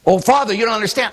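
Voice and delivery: mock whiny complaint. Mocking a complaining parishioner.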